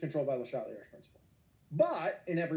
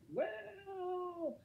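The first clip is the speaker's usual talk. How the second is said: excited high well; 9 dB quieter than usual. Real, not acted. Giddy teacher, inauguration chat.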